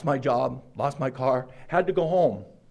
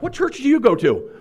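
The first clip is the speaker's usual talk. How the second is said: higher, louder amazed question with a laugh; amazed friends; 10 semitones higher, 5 dB louder than usual. Retold or imitated. Friends hearing about the free car, amazed and laughing. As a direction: play the astonished buddies.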